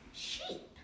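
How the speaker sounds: breathy whispered word; hushed whisper of the name. Acted. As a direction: whisper it like a secret. Book reading to child.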